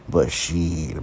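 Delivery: ordinary talk, nothing marked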